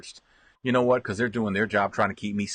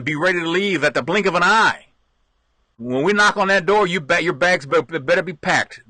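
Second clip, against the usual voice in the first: stern, loud-ish evacuation orders; mock official telling residents to be ready; officials' warning; 5 semitones higher, 4 dB louder than usual. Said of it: "They're telling them".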